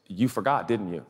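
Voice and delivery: low, knowing accusation; wife catches husband's slip, low and knowing; wife accusing. Retold or imitated. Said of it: "and she goes".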